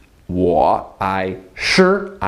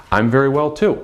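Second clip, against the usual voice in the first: usual speaking voice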